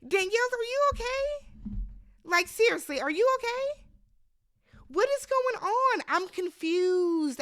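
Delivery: high, pleading disbelief at the player; incredulous. Real, not acted. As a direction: ask her high-pitched, as if baffled. Addressing contestant in disbelief.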